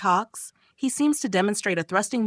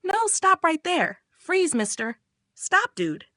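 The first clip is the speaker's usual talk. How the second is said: half-hearted high yell; mild yell; 9 semitones higher, slower than usual. Acted. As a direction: act out calling a halt, pitch jumping up then settling. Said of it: "She yells".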